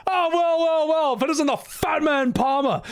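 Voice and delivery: high sneering sing-song with a laugh; mocking Sid greeting. Sid gloating as Palmer arrives, high mocking sneer. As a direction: gloat over the enemy. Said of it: "Sid's like".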